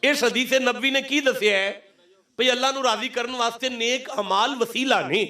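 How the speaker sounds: high, ringing sermon delivery; declamatory preaching. Real, not acted. Preacher asking the congregation what the hadith teaches, declamatory. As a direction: proclaim the lesson to the whole crowd.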